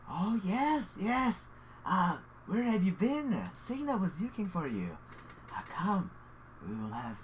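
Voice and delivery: put-on lilting, fussy old acquaintance; flustered welcoming host. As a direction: greet a long-lost friend, a bit flustered. Game master voices innkeeper greeting a returning friend.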